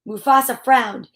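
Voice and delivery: plain reading voice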